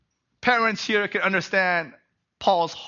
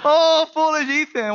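a higher, louder, hammed-up Oh, foolish Ethan; mock despair at your son; playful parental sigh turned into a cry; exasperated parent; 8 semitones higher, 7 dB louder than usual. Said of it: "I wanna say".

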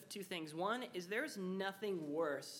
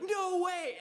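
much higher and louder, delighted; excited shout; 12 semitones higher, 10 dB louder than usual. Retold or imitated. Clifton thrilled to finally connect over Minecraft, excited shout. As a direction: burst out in thrilled disbelief. Joy at finding common ground.

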